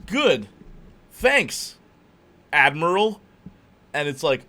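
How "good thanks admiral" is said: "Good", "Thanks", "Admiral" each apart, high and loud; deliberate pun setup. Acted. Movie character landing a pun on the title, deliberately spaced. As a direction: punch each word separately with long pauses. Spelling out GTA pun in acted dialogue.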